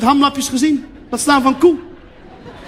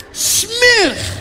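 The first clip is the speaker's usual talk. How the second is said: high, drawn-out cry of disgust; hammed-up revulsion, stretched and soaring; exaggerated disgust; 12 semitones higher than usual. Guest takes his first bite, exaggerated high disgust.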